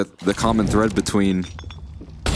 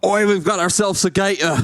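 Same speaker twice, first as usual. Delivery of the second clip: higher, hammed-up Australian twang; Aussie accent; 10 semitones higher than usual. Put on the spot by his co-caster, a hammed-up Aussie accent. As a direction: channel a Steve Irwin Aussie. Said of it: "how's your Aussie accent".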